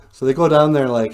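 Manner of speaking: ordinary delivery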